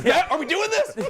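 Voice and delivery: high, laughing mock air marshal. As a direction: play a startled air marshal. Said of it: "my impression of an air marshal".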